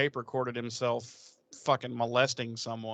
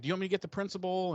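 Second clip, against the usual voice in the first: quick, higher nervous student voice; timid student; 7 semitones higher, much faster than usual. Retold or imitated. Student asking to fetch the principal, quick nervous voice. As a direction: rush it out like a scared kid. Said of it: "a student or two that's like".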